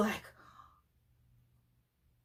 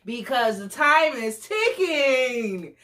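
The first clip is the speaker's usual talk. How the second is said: normal talking voice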